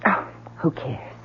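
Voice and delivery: hushed. Acted. Wife dodging husband's questions.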